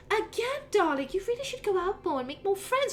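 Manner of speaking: high, fond mum voice; doting mother. Acted. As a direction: gently nag her son. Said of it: "She goes".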